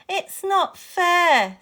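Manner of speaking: high, loud, stretched-out complaint; upset wail. A narrator's reading. Mo learning the robot is gone, upset wail. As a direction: draw it out slowly as a child's wail. Mo is about to cry.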